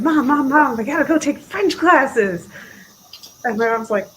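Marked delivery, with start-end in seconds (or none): 0.0-2.5 put-on higher, louder pleading kid voice; imitating her childhood self, eager and loud; eager child begging. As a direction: beg your mom like an excited little kid. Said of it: "and I said".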